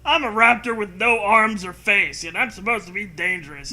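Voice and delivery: high, loud silly creature voice; mock raptor. Narrator voicing an armless raptor mocking itself. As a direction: speak as a sad little monster.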